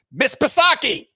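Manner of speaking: high, loud mocking call of her name. Real, not acted. Host taunts Psaki by calling her name, high and loud. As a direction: shout her name across the room to taunt her.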